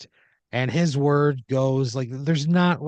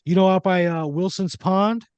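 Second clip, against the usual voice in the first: Paulie character voice; 8 semitones higher, 5 dB louder than usual. Acted.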